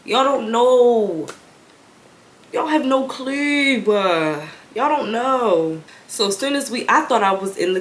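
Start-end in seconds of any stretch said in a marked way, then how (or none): 0.0-5.8 slow, weary y'all don't know; exasperated drawl. Retold or imitated. Daughter silently fed up with parents in the car. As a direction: drag it out, fed up. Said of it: "I'm just like".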